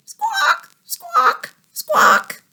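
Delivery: very high-pitched parrot-like squawks; bird squawk. Shown as a demonstration. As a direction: squawk it like a bird, far above your own voice. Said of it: "a bird like this might say".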